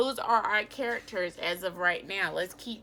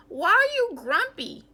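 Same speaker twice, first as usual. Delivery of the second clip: much higher, louder questioning voice; curious bird; 11 semitones higher, 8 dB louder than usual. Acted. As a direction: ask it like a bright, nosy stork. Marabou asks Jim.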